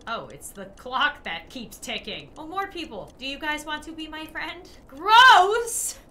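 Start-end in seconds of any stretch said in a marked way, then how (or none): 4.9-6.0 loud, high cry of disgust; recoiling from something gross; disgusted shout. Real, not acted. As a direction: shout it in revulsion. Disgust at game.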